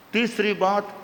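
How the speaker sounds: ordinary talk, nothing marked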